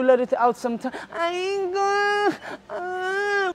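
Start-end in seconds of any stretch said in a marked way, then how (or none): 1.1-3.5 high hammed-up sobbing "I ain't gonna"; mock crying. Injured man admits he must cry, mock sobbing. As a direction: fake a cry, voice cracking high. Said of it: "I have to cry".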